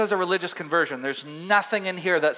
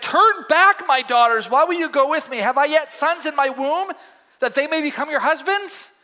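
Naomi's urgent plea, pitched higher; Naomi pleading; 7 semitones higher, 4 dB louder than usual. Acted. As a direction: beg the daughters to turn back, higher and louder. Reads Naomi pleading with daughters.

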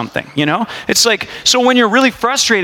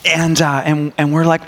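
usual speaking voice

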